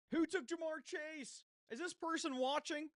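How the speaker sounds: high, slow, loud outraged question; shocked disbelief. Real, not acted. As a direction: cry out in disbelief. Shocked pick taken.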